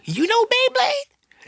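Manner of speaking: father stunned that his son knows the show; surprised dad. Retold or imitated. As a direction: squeak it out in astonishment.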